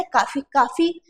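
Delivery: काफी twice as 'KAA-fee'; mispronounced coffee. Retold or imitated. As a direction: mimic the wrong long aa in काफी. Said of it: "ये उच्चारण ही आपका गलत है".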